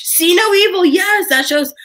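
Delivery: "See No Evil" as a sudden excited recognition. Real, not acted. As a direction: shout "See No Evil" out in delight. Recalls a favourite show excitedly.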